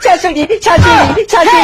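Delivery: high, drawn-out excited calls; slow, high-pitched delighted calling; eager greeting. Acted. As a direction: call out eagerly for your mother-in-law. Son-in-law eagerly greets mother-in-law.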